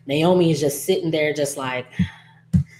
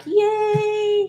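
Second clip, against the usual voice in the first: excited cheer. Retold or imitated. Friends greet Chelsea with hugs.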